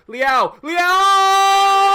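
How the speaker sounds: goal celebration. Real, not acted. Gamer watching his striker score, roaring the name in delight. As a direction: scream his name as the ball hits the net.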